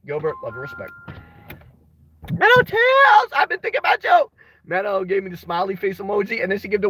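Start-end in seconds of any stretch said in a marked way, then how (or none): 2.3-4.3 excited high greeting. Real, not acted. Streamer greets viewer Meadow in the chat, high excited call. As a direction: call out to a favourite viewer with delight.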